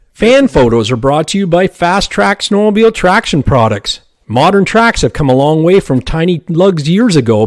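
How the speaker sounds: upbeat commercial read. Acted. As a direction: pitch the product like radio. Reads sponsor ad.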